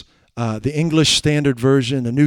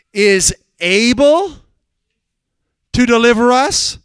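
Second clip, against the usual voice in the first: high, loud, very slow declaration; triumphant sermon; preaching at full force; 7 semitones higher, 4 dB louder, slower than usual. Acted. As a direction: proclaim their faith word by word. Reply of faith preached.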